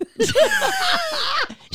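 a high shriek of laughter bursting out. Real, not acted. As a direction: crack up with a squeal. Laughing at her own joke.

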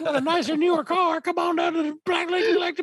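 high shouted ad pitch; wrestling promo. Pretend. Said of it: "cut a promo for us".

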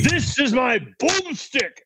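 lightly hammed-up hero line, slow; mock Bruce Campbell bravado; Bruce Campbell melodrama. Actor recalls Bruce's melodrama, slow hammed hero line. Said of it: "everything with Bruce was like".

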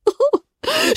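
high, gushing, fluttering mother; a silly mother beside herself with delight; giddy mother rattling off wedding plans; Mrs Bennet, overjoyed. A narrator's reading. Narrator voices Mrs Bennet.

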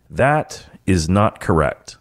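low, slow and deliberate; slow emphatic. Real, not acted. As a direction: lay it down word by word. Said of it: "emphatic correction".

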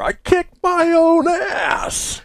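very high, slow, shouted Liar Liar yell; over-the-top comic shout; Jim Carrey impression. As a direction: shriek it out slowly like Jim Carrey. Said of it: "like Jim Carrey in Liar Liar".

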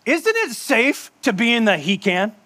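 usual speaking voice

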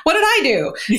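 high, baffled voice of an innocent onlooker; confused bystander. Retold or imitated. Someone insulted by a joke asks what they did, high and baffled. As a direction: squeak it out like you have no idea what you did. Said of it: "everyone's like".

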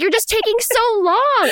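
high, loud imitation of impatient mom; acted-out motherly complaint. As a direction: hurry the daughter along, high-pitched.

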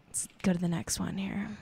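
soft unvoiced whisper; hushed aside, moving to the next one. Real, not acted. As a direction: drop to a breathy whisper as you click on. Moving on through listing photos.

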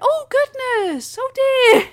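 imitating mum's slow, high dismay; fussing mum. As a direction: draw out a fussy, belated concern. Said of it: "She went".

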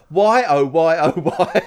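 hammed-up letter-of-complaint despair; mock indignant complainer. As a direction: exaggerate the outraged viewer's lament. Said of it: "we're turning to points of view".

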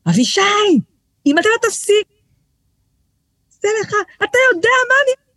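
put-on high, strained yell at a misbehaving child; retold scream. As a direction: scream the threat as if losing it. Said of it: "צרחתי עליו".

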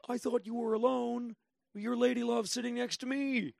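very high, loud acted wrestler voice; over-the-top wrestler outburst; yelling wrestler. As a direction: holler it on a high, held note. Wrestler explains himself in story.

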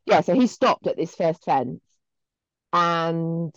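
ordinary delivery

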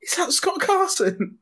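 high, slow, amazed question; surprised. Retold or imitated. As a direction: ask it in total disbelief. Star-struck question to stranger.

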